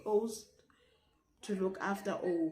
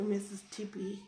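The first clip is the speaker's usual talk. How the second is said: ordinary talk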